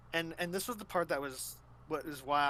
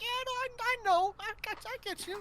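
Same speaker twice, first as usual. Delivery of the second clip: high-pitched. Retold or imitated. Yoshiko replying in the scene.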